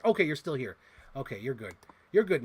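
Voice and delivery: ordinary talk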